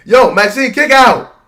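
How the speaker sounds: loud, high shout at the screen; yelling at the TV. Real, not acted. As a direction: shout her name, beg her to kick out.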